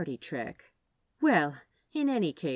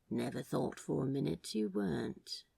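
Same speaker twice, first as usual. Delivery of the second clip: husky; 3 semitones lower, 6 dB quieter than usual. A narrator's reading. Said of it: "Crowley said huskily".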